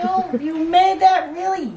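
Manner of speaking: a lightly voiced amazed relative, drawn out slowly; slow, wide-eyed mock disbelief from the family; impressed family member.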